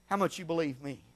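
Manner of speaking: ordinary delivery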